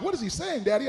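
put-on puzzled child. Kids asking Dad about bleeped TV, imitated child voice.

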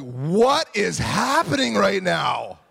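slow, swooping incredulous cry; hammed-up astonishment; retold bewilderment. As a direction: act out baffled disbelief, every word stretched. Retold bewilderment at running crowd.

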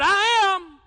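loud, high proclamation of I am; booming declaration. Acted. Preacher voicing Jesus to the soldiers, booming. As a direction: thunder it out.

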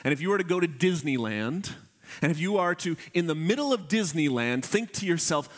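normal talking voice

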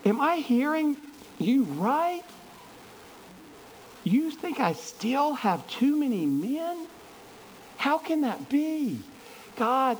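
high, slow, incredulous questioning; astonished Gideon. Acted. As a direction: disbelief climbing high in the voice. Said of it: "it's like".

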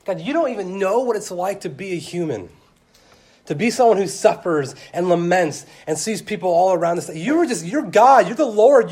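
loud, high, pleading complaint to God; begrudging lament to God. Retold or imitated. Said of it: "he's kind of saying".

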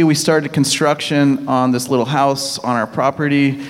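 usual speaking voice

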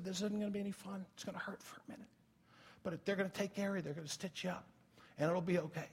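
soft, hushed reassurance close to the ear; whispering to a child. Retold or imitated. Father whispers to his hurt son, hushed and soothing. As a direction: whisper comfort into a scared toddler's ear. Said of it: "I started whispering in his ear".

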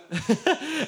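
short high laugh; real amused laugh. Real, not acted. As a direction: burst out laughing. Laughing at own story.

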